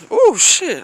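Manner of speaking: sudden high, loud oh shit; startled yelp. Real, not acted. Vlogger slipping on the snow, startled. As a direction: caught off guard by a slip.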